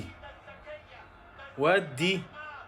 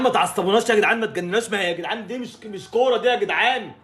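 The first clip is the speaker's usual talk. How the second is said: louder, higher scolding at the players; exasperated yelling; 5 semitones higher, 5 dB louder than usual. Real, not acted. Fan scolding his team's players, exasperated yelling. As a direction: tell them off, fed up.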